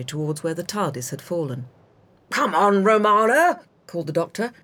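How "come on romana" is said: loud call across the lawn. Acted. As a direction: call out to her, raised. Doctor calls out across lawn.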